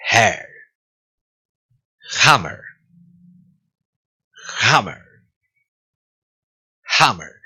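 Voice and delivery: "Hammer" begun with throaty breath; "Hammer" with jota-like h friction; breathy H drill. Shown as a demonstration. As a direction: model "Hair", then "Hammer", each separately aspirated. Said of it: "vamos a practicar la H".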